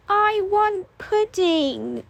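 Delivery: put-on slow, high child wail. A narrator's reading. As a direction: wail it like a sulky toddler. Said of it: "Tabby wailed".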